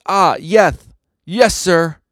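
high, loud flustered reply; nervous obedience. Acted. Guide answers a superior before running away, flustered.

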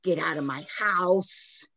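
put-on slow, menacing ghost of an old man. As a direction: warn them off slowly, like a ghost. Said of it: "he basically says".

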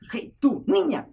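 high, loud mock call; imitated shout. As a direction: imitate a neighbor yelling down at someone. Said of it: "que de arriba me gritan". (grito agudo imitando a la vecina)

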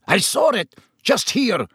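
high, indignant protest; furious. A narrator's reading. Executioner insists the beast was there, furious. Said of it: "said the executioner furiously".

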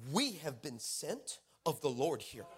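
slow, low, weighty delivery; solemn pronouncement. Retold or imitated. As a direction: declare it slowly like a grave prophecy. Friend's solemn pronouncement, imitated.

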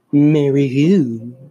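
put-on slow, loud, drawn-out girl's reply; retelling the crush answering, slow and loud; drawn-out question. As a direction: stretch it out slowly. Girl's reply in retold joke.

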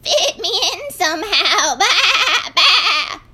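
high, loud quavering bleat; bleating sheep. A narrator's reading. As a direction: sing it out like a woolly sheep. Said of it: "bleating out a song".

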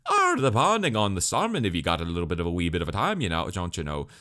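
loud, exaggerated Irish-or-Scottish lilt, mocking the character; mock Irish accent. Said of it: "I don't know why they gave Jin this accent".

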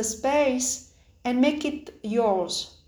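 ordinary talk

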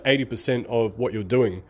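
ordinary talk, nothing marked

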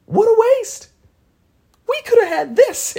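very high, loud cry of exasperation; dismayed outburst. Retold or imitated. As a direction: wail it at the top of your range. Dismayed outburst at wasted life.

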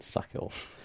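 whispered. Retold or imitated. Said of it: "just said".

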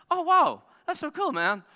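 high, loud gush of hammed-up awe; mock amazement. Rally driver feigning awe at his passenger's job, hammed-up amazement. Acting out his impressed reply.

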